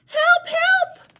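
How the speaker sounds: very high, loud cries for help; Little Penguin calling out. Shown as a demonstration. Teacher voicing Little Penguin calling for help. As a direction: yell through the snowstorm. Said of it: "show you how to use voices".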